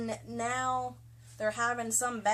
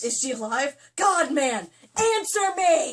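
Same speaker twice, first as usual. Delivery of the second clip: hammed-up desperate shout; 4 semitones higher, 11 dB louder than usual. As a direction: mock-desperate cry, getting louder. Hammed-up dramatic reading.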